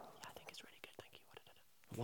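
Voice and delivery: barely audible unvoiced whisper; imitated inaudible whisper. Imitating quiet Lily answering, in a tiny whisper. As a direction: whisper it so nobody can hear. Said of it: "Lily is an inaudible character".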